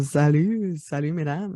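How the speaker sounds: low, raspy greeting; hoarse morning voice. Real, not acted. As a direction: croak a morning hello. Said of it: "Oui, j'ai la voix rauque".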